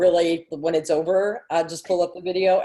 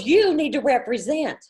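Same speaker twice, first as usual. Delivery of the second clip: higher, swooping Southern sass, breaking into laughter; Southern little girl; 6 semitones higher than usual. Retold or imitated. Friend repeats girl's scolding of her mom, laughing Southern imitation. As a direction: imitate a sassy Southern child, amused. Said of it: "she goes".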